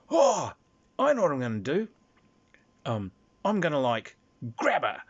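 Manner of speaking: high, loud gleeful plotting; eager scheming. Acted. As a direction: pitch it up like a kid with a bad idea. Player's greedy plan spoken aloud.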